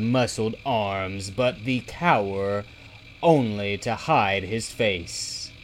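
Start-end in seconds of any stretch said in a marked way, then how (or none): none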